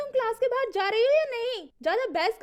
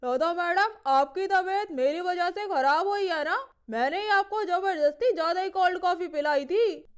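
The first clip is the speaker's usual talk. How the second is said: lower, gentle male colleague; low male teacher voice; 5 semitones lower than usual. Acted. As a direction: play the caring male teacher, pitched down. Rohan sir's voice, man.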